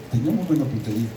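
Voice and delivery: ordinary talk, nothing marked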